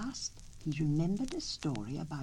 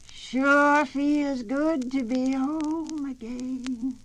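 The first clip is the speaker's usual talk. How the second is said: very slow, high and loud call; gloating turtle; 10 semitones higher, 8 dB louder, much slower than usual. A narrator's reading. Turtle calling to the coyote from the river, slow and high. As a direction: call it out slowly, savoring it.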